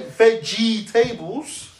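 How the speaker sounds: "vegetables" very slow as ve-jee-tay-bulls; syllable-by-syllable pronunciation. Shown as a demonstration. Podcaster showing his workaround, "vegetables" slowly as ve-jee-tay-bulls. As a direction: sound out "vegetables" in parts, long "jee" and "tay". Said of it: "I can say vegetables".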